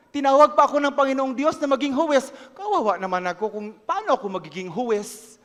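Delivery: usual speaking voice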